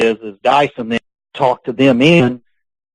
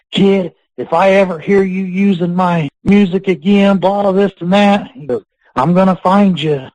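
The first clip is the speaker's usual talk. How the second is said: higher, mock-angry threat from the phone; retold furious threat; 8 semitones higher than usual. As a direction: play the irate veteran, half in jest. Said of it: "he started going nuts".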